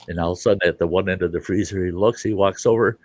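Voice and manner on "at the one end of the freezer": ordinary talk, nothing marked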